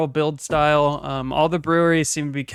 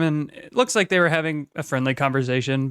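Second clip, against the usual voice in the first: usual speaking voice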